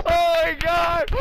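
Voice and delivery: shocked laughter. Real, not acted. Teammate aces in shock.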